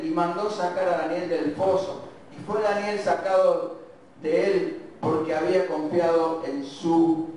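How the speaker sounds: normal talking voice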